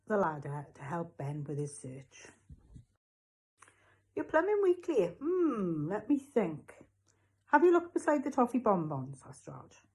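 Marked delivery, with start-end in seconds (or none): 4.1-9.2 high-pitched shopkeeper pondering slowly. A narrator's reading. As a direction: draw it out as if thinking hard. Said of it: "Raj was speaking extremely slowly".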